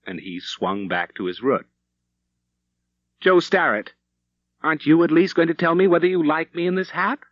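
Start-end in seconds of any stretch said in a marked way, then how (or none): 3.2-7.2 higher and louder, coaxing; teasing wife. A narrator's reading. Wife coaxing her husband to praise her hat, higher, louder. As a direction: press him playfully for praise.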